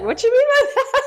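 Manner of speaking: amused incredulous ask; laughing disbelief. Retold or imitated. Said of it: "I was like".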